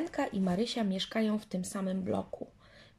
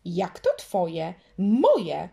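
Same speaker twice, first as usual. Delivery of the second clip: louder, indignant Moje!; sulking girl bursting out louder; sulky outburst; 8 dB louder, much slower than usual. A narrator's reading. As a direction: snap back, offended. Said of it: "naburmuszyła się".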